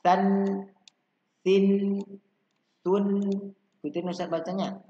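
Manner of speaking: high, loud syllable chanting; energetic drill call-out. Shown as a demonstration. Teacher leads children in spirited read-along. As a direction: shout each syllable for the class to echo. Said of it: "Kita baca sama-sama ya".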